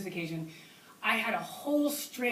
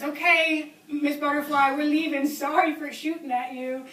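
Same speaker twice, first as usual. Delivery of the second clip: raised, louder calling voice; 5 semitones higher, 5 dB louder than usual. Retold or imitated. Departing hunters apologizing to the tree sitter, calling up. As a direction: call a goodbye up into the tree. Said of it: "they yelled up to us".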